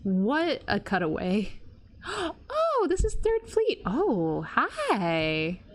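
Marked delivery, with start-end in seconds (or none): 2.0-3.8 high excited gasp and exclaim. Real, not acted. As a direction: gasp in delight, then announce it. Recognizes new area.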